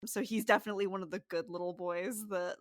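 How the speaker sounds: usual speaking voice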